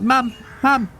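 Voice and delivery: put-on very high child calling out; a kid running to his mother; boy calling mum. Boy calling his mum for help, very high. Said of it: "He's like".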